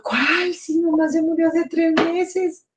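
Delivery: indignado, filho furioso em tom agudo; high-pitched indignant outburst, retold. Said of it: "cuando el hijo viene furioso".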